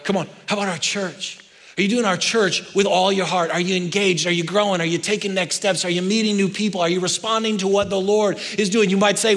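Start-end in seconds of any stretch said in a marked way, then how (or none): none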